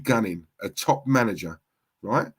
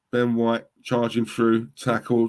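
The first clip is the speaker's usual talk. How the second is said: normal talking voice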